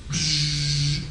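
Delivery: low buzzing machine hum; soft electric buzz imitation. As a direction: imitate the furnace humming as it heats. Imitates the furnace heating up.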